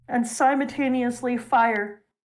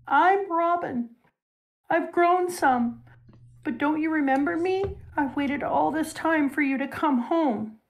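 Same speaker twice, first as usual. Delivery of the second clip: put-on young Robin pleading; 6 semitones higher than usual. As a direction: play a young girl begging her mother not to leave her. Retelling child Robin pleading scene.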